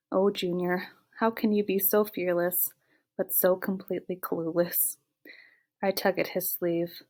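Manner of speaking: ordinary delivery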